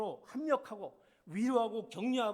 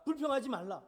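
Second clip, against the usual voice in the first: ordinary delivery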